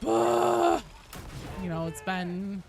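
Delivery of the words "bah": exasperated bah. Retold or imitated. Exasperated fatigue outburst.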